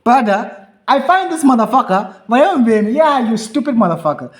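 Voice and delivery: hammed-up fury at the barber; loud mock outrage. Real, not acted. Ranting to his co-host about the barber, loud mock anger.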